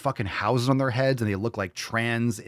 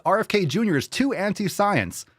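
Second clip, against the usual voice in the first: mocking the left; 9 semitones higher than usual. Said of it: "So the left says".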